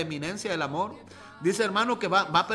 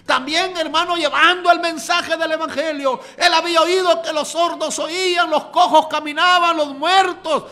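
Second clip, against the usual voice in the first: higher, much louder fervent preaching, building to shouts; preaching at full force; 7 semitones higher, 9 dB louder than usual. Acted. Preacher shouts the blind man's hope to the congregation, fervent. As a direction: proclaim it like a revival preacher at full cry.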